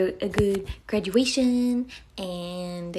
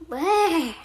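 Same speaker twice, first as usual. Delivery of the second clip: a short, high, louder cute "Burr" growl; playful mock growl; 8 semitones higher, 5 dB louder than usual. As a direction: growl like a little animal for the chat. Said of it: "Is that what you want?".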